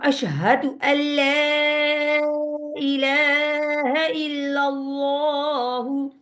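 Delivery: Quranic chant, long and melodic; slow recitation. Shown as a demonstration. Teacher reciting the testimony to students, slow melodic chant. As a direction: recite reverently, stretching every vowel. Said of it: "अशहदु में हमने हा का ख्याल करना है".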